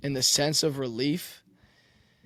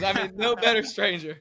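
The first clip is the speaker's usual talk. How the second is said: joking through laughter; laughing; 6 semitones higher than usual. Real, not acted. Quarterback jokingly praises coach through laughter. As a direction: tease while still laughing.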